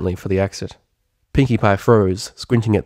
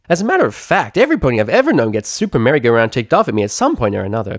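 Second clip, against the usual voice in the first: bubbly pony chatter; 3 semitones higher, 3 dB louder, faster than usual. Acted. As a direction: gush it out without breathing. Pinkie Pie voice.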